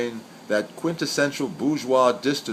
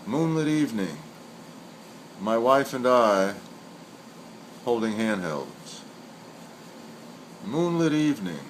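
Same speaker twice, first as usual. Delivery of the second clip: poem recital; 4 dB quieter than usual. Acted. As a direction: let each line of the poem hang before the next. Recites senryu twice.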